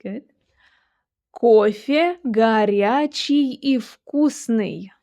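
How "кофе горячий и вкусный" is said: slow, drawn-out phrase for learners to repeat; measured language-teacher demonstration; slow model reading. Shown as a demonstration. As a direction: read it slowly, syllable by syllable. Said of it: "shadow what I'm saying".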